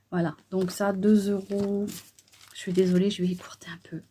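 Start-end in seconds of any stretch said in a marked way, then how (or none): 2.5-4.0 whispered apology, guests nearby. Real, not acted. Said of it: "je parle un peu moins fort".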